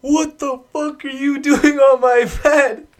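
high, loud and slow angry shout; hammed-up rage at the intruder; mock furious yell. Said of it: "he goes".

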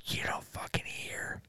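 breathy stage whisper, mock secret. Keeps whispering joke aside.

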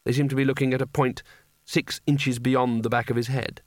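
usual speaking voice

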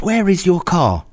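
high-pitched, drawn-out irritated demand; annoyed. Retold or imitated. Annoyed truck driver to stranded customer, high exasperated demand. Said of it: "he's really annoyed".

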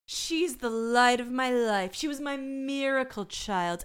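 slow, posh-ish lady voice; wistful rich lady. Retold or imitated. Cohost mocks rich mother, slow put-on lady voice. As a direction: drawl it like a dreamy rich old lady.

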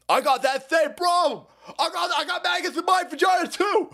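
high falsetto mock shriek; shrieking caricature. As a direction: squeal it like an excited caricature. Mocking an excited poster.

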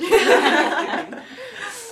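loud, high burst of laughter; real laugh. Real, not acted. Friend hearing about an Encanto fan page, laughing loudly. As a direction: crack up loudly, pitch leaping up.

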